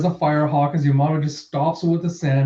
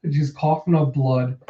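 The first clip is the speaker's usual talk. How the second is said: normal talking voice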